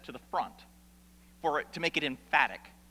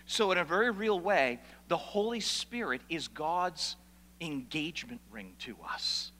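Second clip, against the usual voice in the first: usual speaking voice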